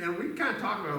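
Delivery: normal talking voice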